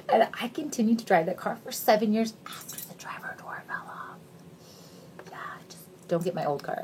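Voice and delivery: hushed, embarrassed whisper. Real, not acted. Admitting to viewers she kept driving it, whispered. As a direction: confess it in a whisper. Whispered confession about the car.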